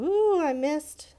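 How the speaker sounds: a high, loud, slow ooh at a slip of the pen; surprised ooh. Real, not acted. Teacher reacting to her own slip while drawing, surprised. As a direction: catch your own small mistake out loud. Slips while coloring grapes.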